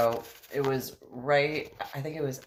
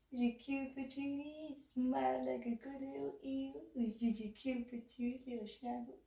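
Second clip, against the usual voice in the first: put-on high, soft baby-talk; cutesy cooing; 12 semitones higher, 11 dB quieter than usual. Model gushing to photographer about an eel, cooing. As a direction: coo as if over an adorable pet.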